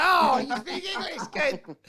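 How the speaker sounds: high, laughing exclamation of relief; retold delighted surprise, pitched high; mock delighted relief. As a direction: squeal it through laughter, hugely relieved. Retold relieved reply to local.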